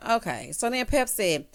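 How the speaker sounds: usual speaking voice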